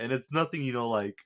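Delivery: ordinary talk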